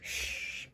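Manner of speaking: unvoiced Shh with teeth showing; shh sound. Shown as a demonstration. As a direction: hiss Shh through the teeth. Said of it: "Then do it now".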